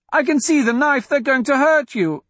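put-on very high, alarmed child's voice; girl protesting. A narrator's reading. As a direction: protest as a frightened little girl. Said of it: "she protested".

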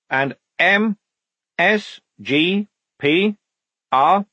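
high, slow separate letters; spelling out. Acted. Lieutenant decoding the flashes, slowly spelling out. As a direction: read out each letter as it arrives.

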